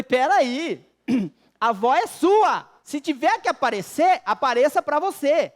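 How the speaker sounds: husband hastily refusing a visit from his wife's dead grandma, loud comic alarm; alarmed protest. Retold or imitated. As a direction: push the ghost back onto her.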